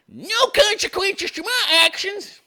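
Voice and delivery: very high, slow put-on voice; mocking voice. Commentator voicing idiots in their little bubble, high mocking voice. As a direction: draw it out in a silly, high mock voice. Mocking council members' attitude.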